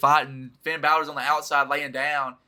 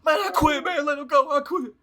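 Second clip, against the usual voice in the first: high, desperate plea to stop; hammed-up desperate surrender; imitating Jey giving up; 11 semitones higher, 3 dB louder than usual. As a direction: beg them to let go, panicked. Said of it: "Jey's like".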